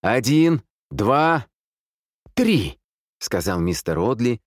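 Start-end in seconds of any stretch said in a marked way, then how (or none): 0.0-2.8 loud, high count with gaps; shouted countdown. A narrator's reading. Man counting to three before firing, shouting. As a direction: call each number out high and hard. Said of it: "крикнул тот же голос из коридора".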